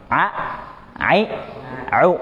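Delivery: "A, ai, au" with the ain pressed from mid-throat; Arabic ain demo. Shown as a demonstration. Teacher models "A, ai, au" for the class. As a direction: model "A, ai, au" drawn from the middle of the throat. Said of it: "siapa yang mau coba".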